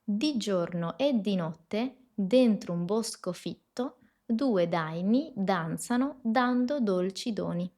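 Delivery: "Di giorno e di notte" slowly, every D dental and clear; slow Italian model sentence. Shown as a demonstration. Teacher reading the last practice sentence aloud, slow and clear. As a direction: read the tongue twister slowly and carefully. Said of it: "Y la última".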